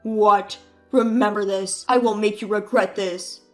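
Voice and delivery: loud, seething vow of revenge; furious threat. Acted. Brother threatens revenge before she hangs up, furious. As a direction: threaten her.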